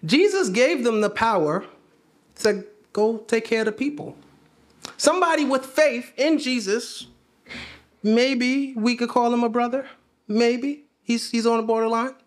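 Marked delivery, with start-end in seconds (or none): none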